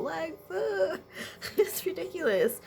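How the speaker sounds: tearful, high-pitched laugh-cry; real tearful emotion. Real, not acted. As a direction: choke up and laugh through it. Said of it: "This is really emotional".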